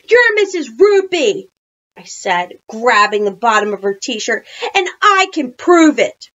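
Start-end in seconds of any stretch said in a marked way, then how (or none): 0.1-1.5 high, loud challenge; loud accusation. A narrator's reading. Child confronts the disguised librarian. 4.7-6.3 high, loud declaration; loud challenge. A narrator's reading. Child confronts the disguised librarian.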